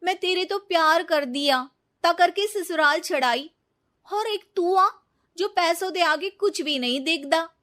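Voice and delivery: loud, high accusing outburst; angry reproach. Acted. Betrayed woman accuses greedy boyfriend, loud and angry. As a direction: hurl the blame at him.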